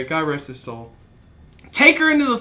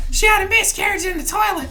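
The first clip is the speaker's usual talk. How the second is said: put-on girl explaining; 13 semitones higher than usual. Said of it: "What the fuck is going on".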